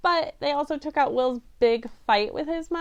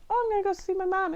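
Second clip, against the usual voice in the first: a higher, quick, breezy take on the boy, lightly voiced; mocking Poe; 7 semitones higher, faster than usual. Reviewer parodying Poe's throwaway movie line, a light higher mock voice. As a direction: toss it off like it's nothing.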